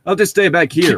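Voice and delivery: acted embarrassed line. A narrator's reading.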